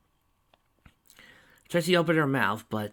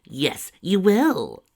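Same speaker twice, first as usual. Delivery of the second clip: drawn-out, louder firm words; very slow, losing patience; 10 semitones higher, 9 dB louder, much slower than usual. A narrator's reading. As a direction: spell it out for a stubborn child. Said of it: "lose her patience".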